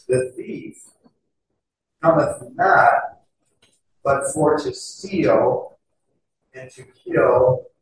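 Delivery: slow, deliberate reading of the verse; solemn scripture reading. Acted. Preacher reading the verse to the congregation, slow and solemn. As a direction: draw out each phrase like holy writ.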